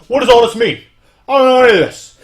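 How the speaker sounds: high, loud confused thug voice; a dumb henchman totally lost; hammed-up baffled yell; bewildered Bullseye. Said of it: "Bullseye's like".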